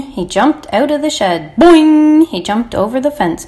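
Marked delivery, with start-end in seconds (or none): 1.6-2.3 loud, higher ringing spring noise; spring up on it, loud and resonant; bouncing boing. A narrator's reading. Lamb bounces over the fence, loud high boing. Narrator imitates spring jumps.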